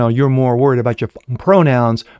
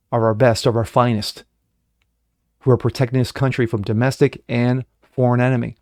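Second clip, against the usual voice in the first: ordinary delivery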